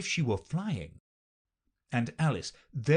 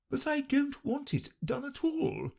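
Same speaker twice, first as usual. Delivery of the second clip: high, whining complaint; groaning. A narrator's reading. Poor Queen complaining to Alice, groaning. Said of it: "groaned the poor Queen".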